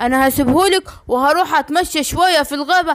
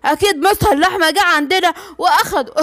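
normal talking voice

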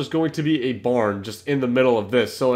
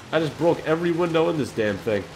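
usual speaking voice

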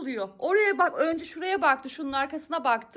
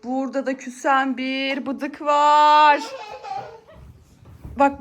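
ordinary talk

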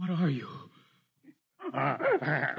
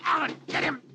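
urgent shout; 10 semitones higher than usual. Acted. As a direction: yell for help in the struggle. Struggle over the gun.

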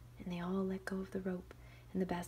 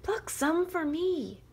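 slow, loud, higher pleading; begging wolf; 11 semitones higher, 10 dB louder, slower than usual. Acted. Wolf begging the girls. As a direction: plead sweetly. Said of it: "the wolf begged".

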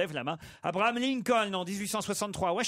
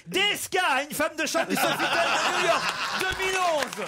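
laughing through it; 11 semitones higher, 3 dB louder than usual. Real, not acted. [name] answers through laughter.

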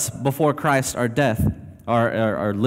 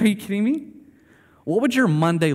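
high, incredulous exclamation; mock astonished outburst, much higher; freaked-out disbelief; 8 semitones higher than usual. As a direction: react like you can't believe your eyes. Imagining freaking out at resurrection.

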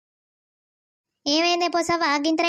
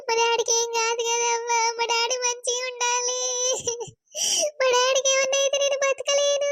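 wailing; 5 semitones higher than usual. Acted. Daughter wails for injured father.

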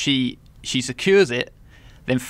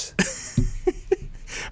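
breathy laugh; 6 dB quieter than usual. Real, not acted. Laughs at flaccid remark.

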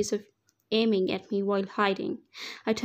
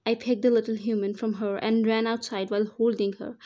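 usual speaking voice

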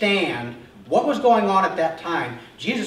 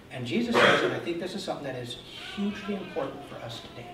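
ordinary delivery

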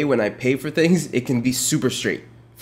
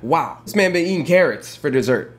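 usual speaking voice

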